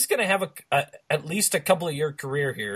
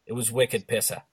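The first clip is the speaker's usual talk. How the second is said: quieter, lower mock Boston accent; bad Boston accent; 4 semitones lower, 5 dB quieter than usual. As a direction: slip into a hokey Boston accent. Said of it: "bad Boston accents".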